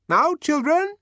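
very high, loud call. Acted. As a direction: call the children over. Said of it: "she called".

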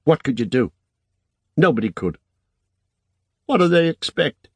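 put-on a girl scoffing at a silly demand; indignant young woman. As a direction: push back hard, rising in disbelief. Elena's indignant lines.

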